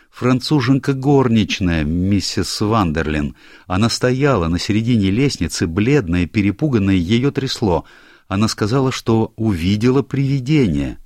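a hint of an anxious young clerk; lightly voiced young secretary. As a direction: play the flustered secretary explaining himself. Secretary's account.

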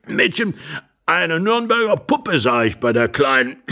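put-on elderly man coughing through the chatter; ponderous old voice, hacking between phrases; rambling old man.

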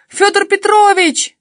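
high, very loud, drawn-out wail to the neighbour; panicked yell. A narrator's reading. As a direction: yell his name at the top of your voice. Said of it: "завопила я".

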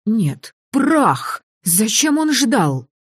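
alarmed, pitch jumping up; panicked curse. Acted. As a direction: blurt it out in fright. Panicked cursing at monster.

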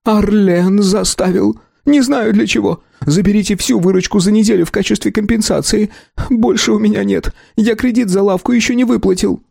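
high, whiny, scared voice; confess, cowering; frightened shopkeeper. A narrator's reading. Shopkeeper confessing to the clan, high and scared.

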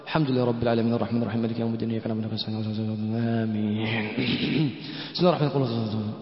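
quick, low, careless recitation; hurried mock prayer. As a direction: imitate people rushing through prayer. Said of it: "هكذا يصلي الناس".

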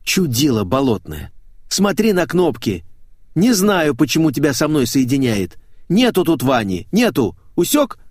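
loud, irate telling-off; angry scolding. Acted. Наташа scolding a caller who misdialled, angry. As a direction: berate the pest on the line.

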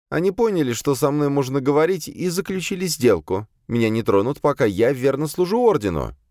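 high-pitched female character voice, matter-of-fact account; servant demoness recounting. A narrator's reading. Azalia begins her account to the exorcist, high servant voice. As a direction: raise the pitch well up for the demoness.

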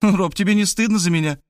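put-on high-pitched girl, pleading her case; coaxing young woman. A narrator's reading. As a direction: talk him round in a high girlish voice. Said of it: "Варвара начала меня уговаривать". (высокий девичий голос, уговаривает)

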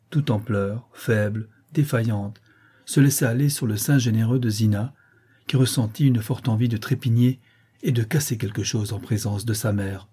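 ordinary delivery

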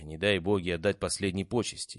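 ordinary delivery, nothing marked

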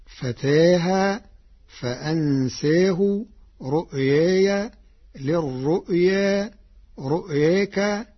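long aa tilted toward e in فتاها, فأنساه, رؤياي, للرؤيا, رؤياك; Warsh's taqlil. Shown as a demonstration. Teacher lists taqlil words, tilted aa in رؤياي. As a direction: lean the alif of فتاها and رؤياك halfway to e. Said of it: "بالتقليل، فهي كالآتي".

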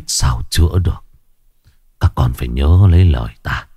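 low, halting deathbed voice; dying father. Acted. Ailing father giving last instructions to three sons, weak and grave. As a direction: labour out each phrase with heavy pauses.

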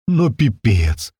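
slow, stunned exclamation; stunned, forced out. A narrator's reading. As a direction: squeeze the words out in disbelief. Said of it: "выдавил я из себя".

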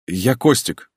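lightly voiced nervous son; young man's voice. A narrator's reading. Son introduces himself to mother. (робкий голос сына)

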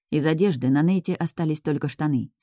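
usual speaking voice

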